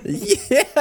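high laughing yes; triumphant yes. Real, not acted. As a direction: celebrate through a laugh. Players cheer explosion.

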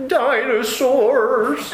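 put-on high, drawn-out crying wail of a scared little girl; imitating daughter crying. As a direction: wail it like a frightened child in tears. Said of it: "she started really crying".

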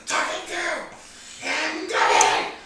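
raspy goblin outburst, pitch lurching; boasting goblin. Acted. Player as goblin boasting to a foe, raspy and worked up. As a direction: rasp it out as an indignant little goblin.

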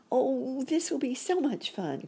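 put-on high, gleeful child-like squeal; excited cry. A narrator's reading. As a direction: burst out high with glee. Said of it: "cried Sammy".

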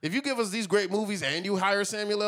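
high, loud laughing rant; excited. Real, not acted. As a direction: push back laughing. Laughing, heated defense.

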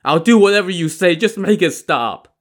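high, hurried, loud begging; desperate pleading. A narrator's reading. Li Kui promises obedience to stop the magic, pleading. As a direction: rush out a frantic promise.

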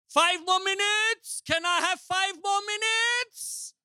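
high, drawn-out preacher's plea; imitating an evangelist begging the crowd; Reinhard pleading from pulpit. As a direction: call it out high and slow, rising at the end. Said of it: "Reinhard used to say".

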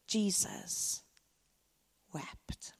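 soft, slow, fading almost to a whisper; solemn and hushed, with a long pause between the words; hushed solemnity. Real, not acted. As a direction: let the last word fall away very quietly. Solemn climax of the grief story.

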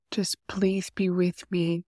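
usual speaking voice

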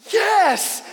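put-on high, loud, excited shout of a boy; thrilled kid. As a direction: shout it like a kid at the bottom of a hill. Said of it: "He's like".

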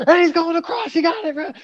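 high excited shouting at the screen; mock sports-fan screaming; excited yell. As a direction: cheer him on like a fan going wild. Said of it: "screaming the whole time".

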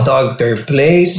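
slow, let-down repeat; disappointed mumble. Retold or imitated. Cuban hears only third place, slow and let down. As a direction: sink at the news. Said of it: "I was like".

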